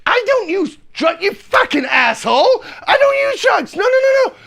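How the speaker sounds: high, loud angry denial; loud high outraged yell; furious shout. Retold or imitated. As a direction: scream denial at the cop.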